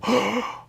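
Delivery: high sharp intake of breath; sharp gasp. Retold or imitated. Hearing he was murdered, a high shocked gasp. Acting out his own reaction.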